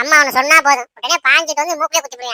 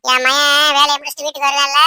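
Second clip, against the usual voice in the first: ordinary talk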